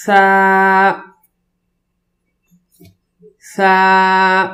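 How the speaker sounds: سا as a lisped "thaa", vowel held level; tongue tip out between the teeth for a long سا; interdental articulation. Shown as a demonstration. Teacher models for students, سا with tongue between teeth. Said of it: "زبان کو دونوں دانتوں کے درمیان".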